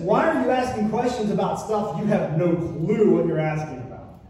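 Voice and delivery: loud, high, half-laughing scolding; stern rebuke. Acted. God challenging Job's questions, loud and stern. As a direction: scold him with a grin.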